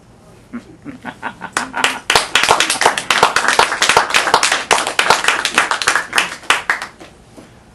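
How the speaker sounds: a big burst of laughter; loud laugh. Real, not acted. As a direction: laugh out hard after the poem. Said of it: "Um, I don't know".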